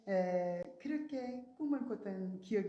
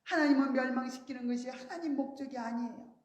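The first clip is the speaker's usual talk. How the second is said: ordinary delivery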